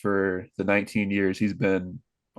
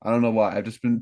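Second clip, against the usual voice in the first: usual speaking voice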